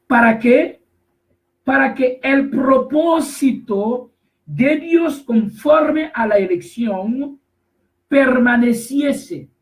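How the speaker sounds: slow, drawn-out scripture reading; lectura bíblica enfática. Acted. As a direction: proclaim the verse slowly, word by word. Continúa la lectura del versículo. (lectura lenta y solemne del versículo)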